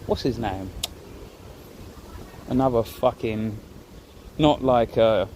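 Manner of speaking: usual speaking voice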